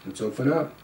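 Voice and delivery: plain reading voice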